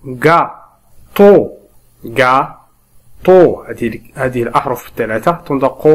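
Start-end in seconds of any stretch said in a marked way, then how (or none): 0.0-1.5 "gâteau" in two firm separate beats, gâ, pause, teau; syllable by syllable. Shown as a demonstration. Teacher splits second word for learners, syllable by syllable. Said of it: "نقسم هذه الكلمات إلى مقاطع". 2.0-3.7 "Gâteau" in two loud separate beats; syllable by syllable. Shown as a demonstration. Teacher repeats the split word, syllable by syllable. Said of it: "نقسم هذه الكلمات إلى مقاطع".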